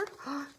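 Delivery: soft gasp of delight. Real, not acted. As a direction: gasp at the cute picture. So cute.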